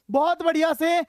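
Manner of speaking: normal talking voice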